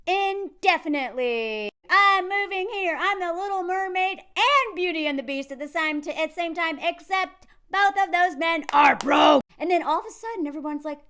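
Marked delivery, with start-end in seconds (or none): 0.1-9.4 loud, high, hammed-up announcement; over-the-top gleeful proclamation; mock triumphant declaration. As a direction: proclaim it grandly, building to a shout. Acting out the triumphant answer.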